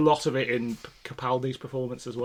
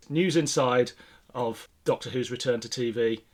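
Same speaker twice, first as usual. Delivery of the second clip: ordinary talk, nothing marked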